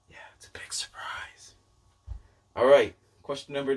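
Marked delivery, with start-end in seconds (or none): 0.1-1.5 whispered teaser, hushed and conspiratorial; secret whisper. Acted. Booktuber teasing viewers about his surprise, whispered. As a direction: let them in on a secret in a whisper.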